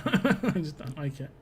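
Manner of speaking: slow, laughing through the words; chuckling as he repeats himself. Real, not acted. Streamer laughing off his dislike of bad accents.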